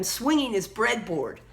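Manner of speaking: usual speaking voice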